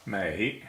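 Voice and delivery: "Mei" with a rising tone; second tone. Shown as a demonstration. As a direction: say "Mei" in Mandarin second tone, sweeping upward. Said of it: "it is second tone".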